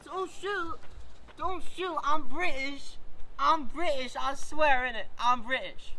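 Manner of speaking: high, panicked begging not to be shot; pleading in fear. Acted. Caught hiding, begging not to be shot.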